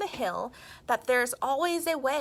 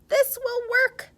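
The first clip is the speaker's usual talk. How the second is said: high, loud proud announcement; 14 semitones higher, 7 dB louder than usual. A narrator's reading. Rocks proud of their rubber balls, high confident announcement. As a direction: proclaim it with pride. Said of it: "they announced".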